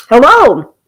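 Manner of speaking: loud, high call to the chat; loud hello. Real, not acted. Host greets her live viewers with a loud hello. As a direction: sing out a big greeting.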